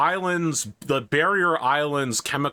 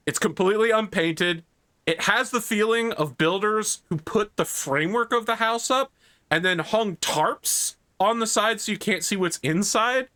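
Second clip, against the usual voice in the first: usual speaking voice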